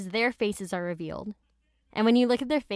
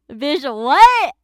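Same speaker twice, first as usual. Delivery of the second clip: shocked outburst; 6 semitones higher, 14 dB louder than usual. Real, not acted. Outraged aside to viewers.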